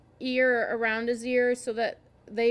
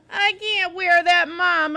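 high, loud, drawn-out whine; slow, pleading kid-like whine; whining; 6 semitones higher, 9 dB louder, slower than usual. A narrator's reading. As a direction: whine in protest to a parent. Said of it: "I whined".

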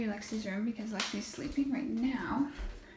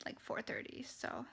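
whispering; 10 dB quieter than usual. Real, not acted. Whispering near sleeping baby.

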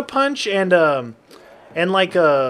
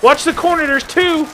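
urgent warning; 11 semitones higher, 3 dB louder than usual. Real, not acted. Warning teammate.

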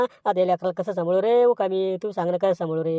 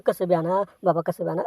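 ordinary talk, nothing marked

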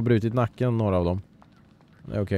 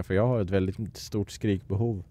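ordinary delivery

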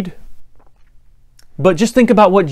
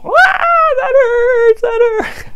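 hammed-up wailing; much slower than usual. The boy fakes pain for his parents, very high hammed-up wail. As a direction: overdo the pain to stop the spanking. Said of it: "wailing and wall".

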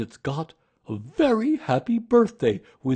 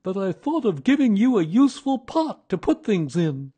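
loud, high, pleased bear voice; gleeful storybook bear; happy Pooh; 11 semitones higher, 11 dB louder than usual. A narrator's reading. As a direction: declare it with big delight. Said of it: "said Pooh happily".

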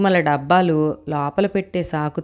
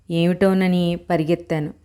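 ordinary delivery

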